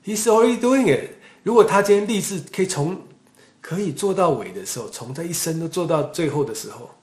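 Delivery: loud, high, driving sermon voice; forceful preaching. Acted. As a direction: preach it hard to the congregation.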